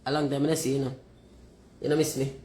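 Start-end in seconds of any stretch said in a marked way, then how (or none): none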